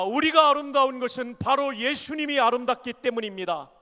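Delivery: loud, ringing line for the congregation to repeat; call for repetition. Shown as a demonstration. Preacher leading the congregation in a repeated line, proclaiming loudly. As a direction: proclaim it so the church can echo you. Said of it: "한 번 더 따라합니다".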